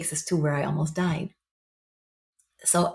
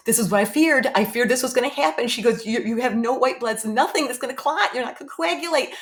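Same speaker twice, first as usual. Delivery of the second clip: higher, rising anxious doctor; worried surgeon; 7 semitones higher than usual. Retold or imitated. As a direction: fear it is happening. Surgeon voicing her fear.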